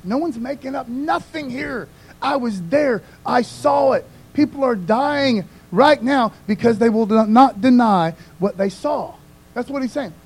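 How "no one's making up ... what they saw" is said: high, loud urgent plea; urgent Peter. Retold or imitated. Peter to readers, insisting urgently. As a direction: grab them and insist. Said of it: "So Peter's like saying".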